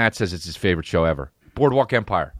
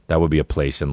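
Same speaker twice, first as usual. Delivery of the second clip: normal talking voice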